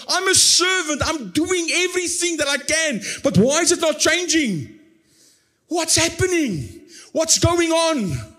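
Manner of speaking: high, despairing plea, slowing at the end; crying out. Retold or imitated. Pastor acting a burdened servant crying to God, high and pleading. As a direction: let the questions hang, pleading up high. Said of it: "I'm actually crying out".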